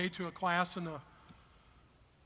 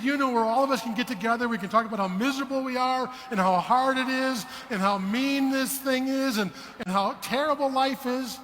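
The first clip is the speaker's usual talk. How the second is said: louder, slowing, hammed-up moaning about how hard life is; mock whiny complainer; 6 semitones higher, 8 dB louder than usual. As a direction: lay on the self-pity, dragging it out. Bob mocks complaining tithers.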